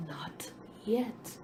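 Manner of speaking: soft whisper. A narrator's reading. Master quietly answering the dizzy dough, a soft whisper. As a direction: breathe it out quietly. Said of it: "and said quietly".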